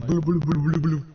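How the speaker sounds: glitchy repeated blue; underwater imitation. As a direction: mimic a garbled call. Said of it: "I'm making gub gub sounds".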